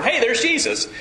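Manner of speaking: mock casual discovery. Man just outside his front door spots Jesus, mock casual discovery. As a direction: pipe up as if spotting someone outside.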